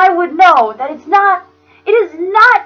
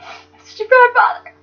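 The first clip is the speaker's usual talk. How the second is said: mock crying; 6 semitones higher than usual. Mock crying bad father.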